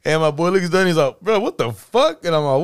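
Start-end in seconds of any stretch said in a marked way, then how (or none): 1.2-2.2 high, slow, stunned "what the fuck"; shocked disbelief. Retold or imitated. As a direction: recoil at something gross. Coworker spots nail clippings.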